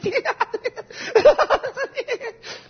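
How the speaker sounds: shrill cackle of mockery; high mocking laugh. Preacher laughing after imitating a flirt, high mocking laugh.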